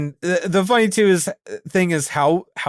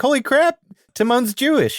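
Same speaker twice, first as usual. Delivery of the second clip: surprised; 11 semitones higher than usual. Retold or imitated. Co-host retelling the Berkowitz name discovery, surprised. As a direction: replay your shocked reaction, pitch jumping way up. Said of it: "I was so surprised by that".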